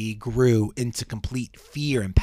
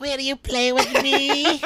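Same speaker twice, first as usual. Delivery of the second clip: high, slow creepy doll voice; too calm creepy doll; 13 semitones higher, 4 dB louder, slower than usual. Acted. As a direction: ask sweetly like a haunted doll. Acting ghost girl Molly.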